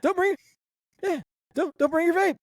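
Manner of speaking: high-pitched mock scolding. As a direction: squeak out the rule like a fussy teacher. Mocking a school rule in a put-on voice.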